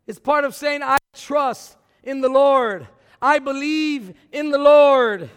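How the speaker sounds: loud, slow declaration of trust; slow it down and declare each word; emphatic preaching. Acted. Preacher at full force.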